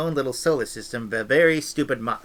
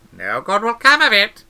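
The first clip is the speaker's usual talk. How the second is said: put-on old witch warning, higher and louder; a stern old countrywoman cautioning the girl; lightly voiced stern old witch; Granny; 7 semitones higher, 7 dB louder than usual.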